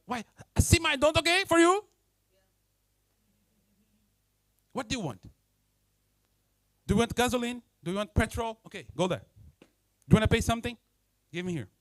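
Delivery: defensive and snappy, rising at times; rude clerk. Retold or imitated. A grumpy worker snaps at a customer, defensive and rude. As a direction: brush the customer off rudely. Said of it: "And you say".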